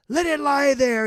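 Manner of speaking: high, loud scream. Acted. Stranger screams as he throws the harp away. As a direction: hurl it out after the harp. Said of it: "he screamed then".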